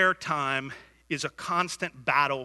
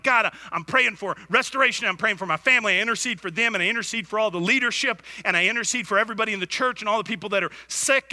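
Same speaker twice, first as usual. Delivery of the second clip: fast, louder, rattling prayer list; hurried mock prayer; 4 semitones higher, 4 dB louder, faster than usual. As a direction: rush through the prayer list. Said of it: "So I'm like".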